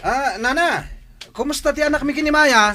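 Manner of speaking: high, loud, fast mock greeting. The man mockingly greets Maya's mother, sneering. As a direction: sneer through a fake friendly hello.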